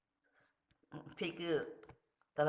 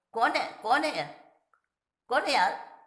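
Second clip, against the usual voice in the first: loud demand; 8 semitones higher, 7 dB louder than usual. Acted. The second voice calls out.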